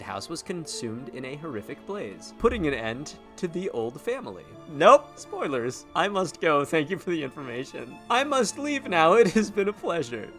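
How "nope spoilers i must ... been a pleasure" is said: high, loud hurried farewell; bow out in a rush; hasty exit. Pretend. Rushing out of conversation.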